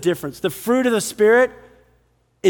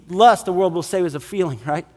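ordinary talk, nothing marked

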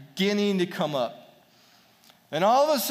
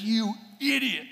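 lightly exasperated, pitched-up retort; mock scolding; 6 semitones higher than usual. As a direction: scold a fool in a retelling. Retold scolding of careless shooter.

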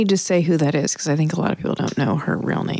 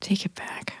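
whispering; 4 semitones lower, 5 dB quieter than usual. Real, not acted. Teasing whisper at partner.

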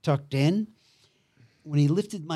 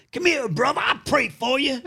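high-pitched mock holler, no real volume; retold yell; 10 semitones higher than usual. Pastor to a man in the other line, high mock yell. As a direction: imitate a preacher calling someone over, voice way up. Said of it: "And he yells out".

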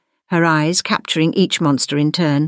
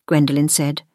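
plain reading voice

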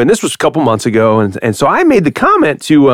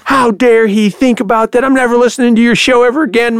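mock outraged caller; 10 semitones higher than usual. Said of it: "they were calling in and".

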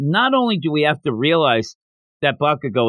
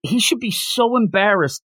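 usual speaking voice

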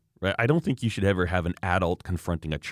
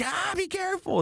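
very high-pitched mock panic cry; oversensitive skier. Skier passed twenty feet away, mock panic. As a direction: squeal it like an overreacting skier. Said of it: "some people are overly sensitive".

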